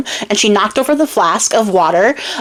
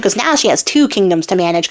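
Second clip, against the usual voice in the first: usual speaking voice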